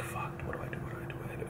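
whispered, panicking to himself; panicked whisper. Retold or imitated. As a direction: whisper it in a frantic rush to yourself. Panicking silently in the car.

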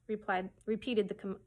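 plain reading voice

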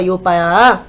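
ordinary delivery

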